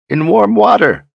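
put-on high, loud old man bellow; loud, high wizard's shout. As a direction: bellow the order across the room. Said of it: "Zed bellowed".

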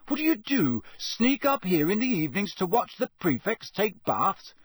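high-pitched, scandalised questioning; indignant. Acted. Harry accuses ghost of watching prefects bathe, indignant. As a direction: throw it as an affronted accusation. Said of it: "said Harry indignantly".

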